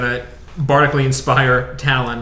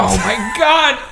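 shocked shout; 10 semitones higher, slower than usual. Real, not acted. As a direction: react to a disastrous roll. Failed roll reaction.

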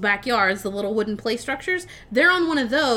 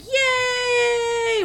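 high, long drawn-out yay; excited yell; 13 semitones higher than usual. Retold or imitated. Retelling a kid thrilled to go flying, mock yell of joy. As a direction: stretch it out in one high held cheer. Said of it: "he's yelling".

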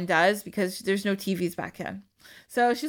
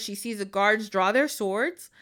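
usual speaking voice

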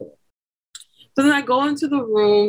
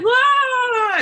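singsong yelling. Retold or imitated. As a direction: yell the las through a contraction. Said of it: "Why are you yelling?".

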